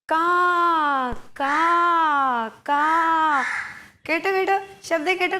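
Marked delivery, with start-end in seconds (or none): 0.1-3.4 three separate drawn-out caws; crow caw. Shown as a demonstration. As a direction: imitate a crow for children, pausing between calls. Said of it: "കാക്ക എങ്ങനെയാണ് ശബ്ദം ഉണ്ടാക്കുന്നത്".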